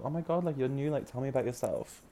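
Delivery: high, light imitation of curious admirers; fawning newcomer greeting. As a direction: lightly mimic people fussing over someone new. Voicing people greeting the newcomer.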